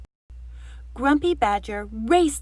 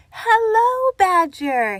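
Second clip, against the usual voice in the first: bright, much higher and louder greeting; a friendly squirrel calling at the door; cheery Squirrel; 10 semitones higher, 7 dB louder, slower than usual. Acted. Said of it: "he said cheerily".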